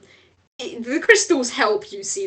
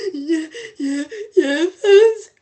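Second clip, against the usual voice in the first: tearful; 8 semitones higher, 6 dB louder, slower than usual. Acted. Mo still crying, voiced.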